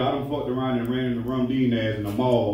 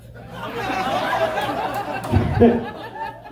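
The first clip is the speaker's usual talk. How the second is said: long high giggle; high breathy laugh. Real, not acted. Laughing at own joke.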